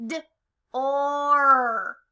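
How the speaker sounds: "D-or" split, with a long loud "or"; short D, then drawn-out swelling "or"; stretched-out word. Shown as a demonstration. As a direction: sound out "D-or", holding the vowel. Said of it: "Stretch out door".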